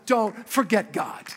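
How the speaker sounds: ordinary talk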